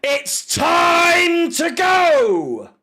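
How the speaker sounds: long-drawn, high shouted catchphrase; Claude. Retold or imitated. Fan quoting a famous rant at the manager, drawn-out shout. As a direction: bellow it, stretching the words right out. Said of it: "As Claude once very iconically said".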